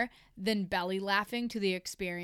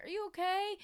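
concerned; 10 semitones higher than usual. Retold or imitated. Asking injured man, retold.

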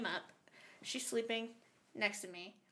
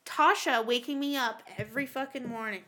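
ordinary delivery

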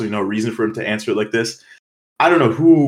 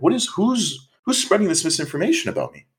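baffled outrage; 8 semitones higher than usual. Retold or imitated. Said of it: "but I was just like".

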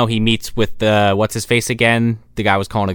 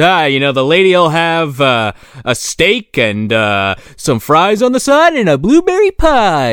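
higher, slow, overdone ordering voice; mock smug hero; 7 semitones higher, 4 dB louder, slower than usual. Podcaster mocks the hero ordering for the woman, high and slow. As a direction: drag out the order like a smug know-it-all.

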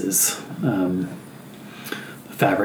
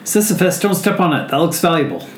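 higher, urgent warning voice; alarmed warning; 8 semitones higher, faster than usual. Acted. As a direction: call out to stop him stepping on it. Character warns a companion.

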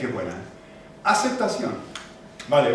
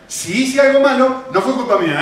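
loud, higher SI HICE ALGO MALO; firm shrug-off of blame; self-justifying; 6 semitones higher, 5 dB louder than usual. Acted. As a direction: excuse yourself emphatically. Acting out self-justifying excuse.

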